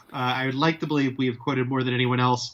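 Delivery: ordinary delivery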